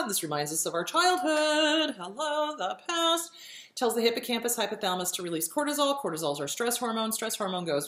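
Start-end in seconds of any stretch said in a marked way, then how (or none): none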